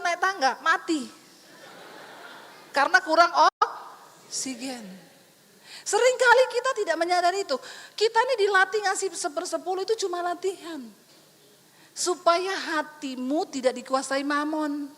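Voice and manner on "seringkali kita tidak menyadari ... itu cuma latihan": ordinary talk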